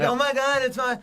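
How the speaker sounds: high, bright mock-flamboyant gush; flamboyant friend voice. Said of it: "like, flamey".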